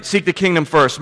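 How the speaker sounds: ordinary talk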